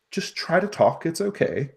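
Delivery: a chirpy gnome talking to a cat. Acted.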